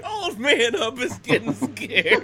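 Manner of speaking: high, slow words through laughter; cracking up while repeating it; laughing. Real, not acted.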